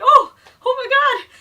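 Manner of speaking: high, loud startled squeal; shocked gasp. Retold or imitated. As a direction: caught red-handed, yelp it out. Caught trash-talking near Goofy.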